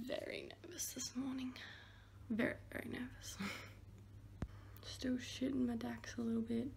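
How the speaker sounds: hushed early-morning whisper. Real, not acted. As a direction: whisper so nobody wakes up.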